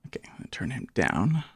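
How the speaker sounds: fast whispered aside; hushed so the loud voice isn't disturbed. Real, not acted. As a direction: whisper it quickly under the noise. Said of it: "he's so loud".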